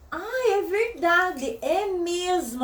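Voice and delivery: very slow, drawn-out agreement; mock-patient reply, holding back impatience; exaggerated self-restraint. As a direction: agree slowly while itching to burst out. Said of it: "Assim, louca pra já".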